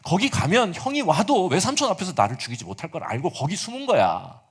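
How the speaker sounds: usual speaking voice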